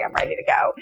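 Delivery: high, whispered urging; whispered impatience. Retold or imitated. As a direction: hiss it, desperate to leave. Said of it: "And I'm like".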